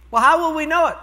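high, loud, worried question; alarmed trainee. Retold or imitated. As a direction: blurt it out in disbelief.

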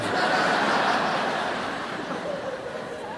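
high breathy burst of laughter; big laugh. Real, not acted. Preacher laughing at his own story, high burst.

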